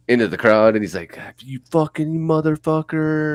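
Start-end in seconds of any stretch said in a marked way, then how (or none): 1.4-3.3 mock rock-star yell, higher pitched; imitating a yell. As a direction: ham up a singer cursing at the crowd. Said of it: "he starts fucking yelling".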